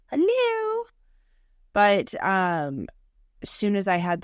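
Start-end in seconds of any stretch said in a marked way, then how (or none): 0.1-0.8 squeaky, pointed hello; high mock hello. Body nagging its owner, high impatient hello.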